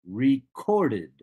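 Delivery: "recorded" slowly, re-CORD-ed, CORD punched; stress on second syllable. Shown as a demonstration. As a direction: lean hard on the middle of "recorded". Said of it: "The second syllable is stressed".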